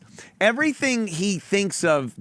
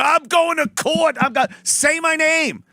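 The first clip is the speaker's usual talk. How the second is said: mock litigious shout; 8 semitones higher, 3 dB louder than usual. Mocking litigious John.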